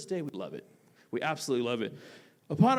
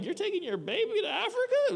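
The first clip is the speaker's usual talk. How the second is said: incredulous friends. Retold or imitated. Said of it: "a lot of my friends were like".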